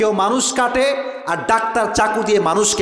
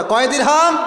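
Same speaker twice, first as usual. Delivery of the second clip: higher-pitched call to the crowd; raised, insistent question; rousing prompt; 5 semitones higher, 3 dB louder than usual. Real, not acted. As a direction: call out the question for a congregation to answer. Repeats prompt after urging an answer.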